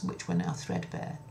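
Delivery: ordinary delivery, nothing marked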